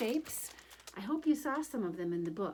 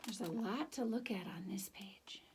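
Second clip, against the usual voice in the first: soft whispered aside; hushed aside about the page; 5 dB quieter than usual. Real, not acted. Aside while showing the page.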